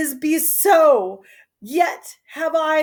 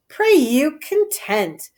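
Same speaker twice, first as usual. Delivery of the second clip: ordinary talk, nothing marked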